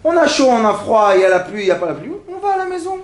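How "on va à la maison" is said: mock crying whine. Mock complainer crying to go home.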